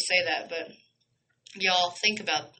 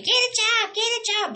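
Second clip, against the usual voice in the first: mock scream; 14 semitones higher than usual. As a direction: shriek it like the hecklers do. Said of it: "So you screaming".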